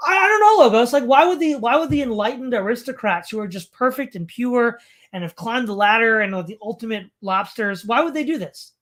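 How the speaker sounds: high, loud burst of mock bafflement, easing off; sarcastic mock wonder, starting high and forceful. Real, not acted. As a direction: feign outraged disbelief with heavy sarcasm. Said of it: "I'm being sarcastic".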